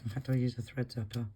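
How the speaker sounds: ordinary delivery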